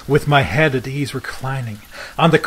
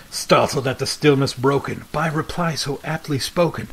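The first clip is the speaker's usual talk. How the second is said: plain reading voice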